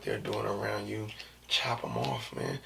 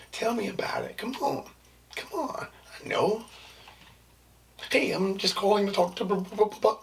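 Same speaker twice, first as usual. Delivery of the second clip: high work voice; 9 semitones higher than usual. Acted. Acting work persona on phone.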